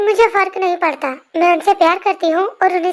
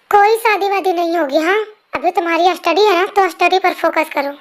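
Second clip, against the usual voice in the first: usual speaking voice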